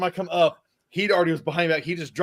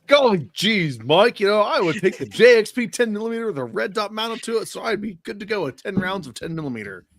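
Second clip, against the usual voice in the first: higher, louder gee-whiz answer; mock wholesome enthusiasm; hammed-up folksy; 8 semitones higher, 5 dB louder than usual. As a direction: play the eager aw-shucks guest. Mock folksy answer to guest question.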